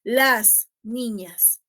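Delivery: las niñas said very slowly and clearly; slow model phrase. Shown as a demonstration. Teacher modeling correct agreement for her students. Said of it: "nosotros decimos".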